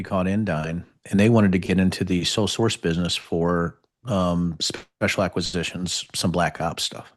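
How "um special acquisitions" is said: ordinary delivery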